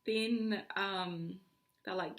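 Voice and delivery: usual speaking voice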